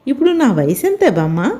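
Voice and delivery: ordinary delivery, nothing marked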